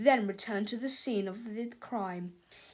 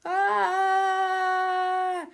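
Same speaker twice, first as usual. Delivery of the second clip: loud high falling scream; boys' scream; 7 semitones higher, 7 dB louder than usual. A narrator's reading. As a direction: scream as you fall into the mouth. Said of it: "The two boys screamed".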